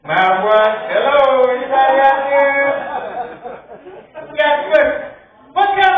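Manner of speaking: calling out loud to the room; hey, are you awake out there; loud call to crowd. Real, not acted. Comic berating a silent crowd.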